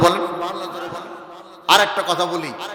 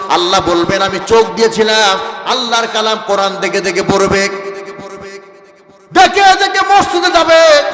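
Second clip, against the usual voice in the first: forceful, driving sermon delivery; preaching; 6 dB louder than usual. Acted. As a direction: preach it loud to the crowd, drawing out the line endings. (জোরালো ওয়াজের সুর)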